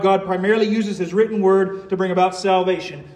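ordinary talk, nothing marked

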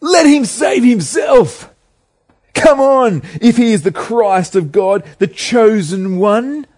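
high, loud mocking jeer; religious rulers taunting Jesus on the cross, loud scornful jeer; scoffing taunt. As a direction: throw the scorn up at the man on the cross. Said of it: "The rulers scoffed at him".

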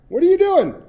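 high, loud alarmed cry of a new parent; startled outburst at the doctor; shocked parent. Acted. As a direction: demand it in sudden alarm. Parent shocked at salt-shaker doctor.

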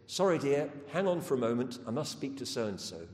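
a hurried brush-off to someone on hold; mock relative on the phone. A relative putting you on hold, loud and brisk.

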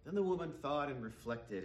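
normal talking voice

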